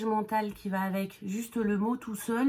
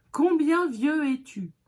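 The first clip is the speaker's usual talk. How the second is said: mock literal question; 7 semitones higher, 6 dB louder, slower than usual. Shown as a demonstration. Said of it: "vous allez demander à un enfant".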